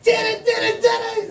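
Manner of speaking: high, shouted victory chant; high shouted mock cheer; mock triumph. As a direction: celebrate like a kid. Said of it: "I'd be like".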